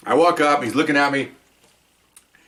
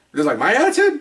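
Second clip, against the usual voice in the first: higher, incredulous echo; indignant disbelief; 8 semitones higher than usual. Retold or imitated. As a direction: throw his words back in disbelief. Cop incredulous at translator.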